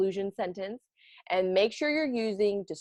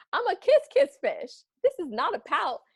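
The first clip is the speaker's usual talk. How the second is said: much higher, louder gleeful chant; a bubbly cheerful fish; joyful kiss fish; 9 semitones higher, 4 dB louder than usual. Acted. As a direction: burst out happily. Fish's new happy song.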